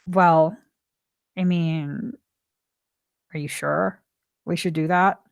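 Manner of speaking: low, soft hesitant husband asking. Retold or imitated. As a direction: gently question the plan. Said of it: "he would be like".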